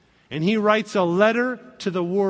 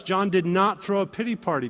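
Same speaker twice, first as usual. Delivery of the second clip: usual speaking voice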